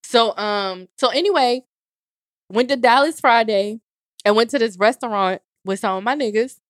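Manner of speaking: ordinary talk, nothing marked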